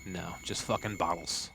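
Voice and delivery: low, soft grumble; muttered disappointment. Real, not acted. Disappointed find, first muttered take.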